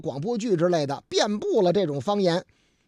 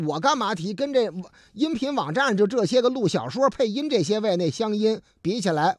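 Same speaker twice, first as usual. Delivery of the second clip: ordinary talk, nothing marked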